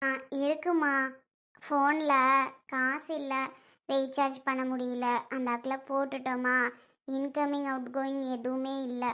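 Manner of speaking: low, hushed, halting explanation; subdued admission. Acted. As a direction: admit it quietly, pitch dropped, with small pauses. Admitting the phone has no credit.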